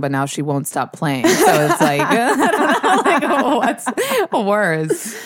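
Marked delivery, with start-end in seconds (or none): 1.3-5.2 high, slowed words through laughter; laughing. Real, not acted. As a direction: laugh while talking. Laughing while teasing friend.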